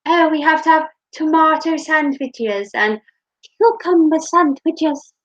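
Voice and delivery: light mock-posh hostess. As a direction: play a grand lady listing sandwiches. Posh sandwiches talk.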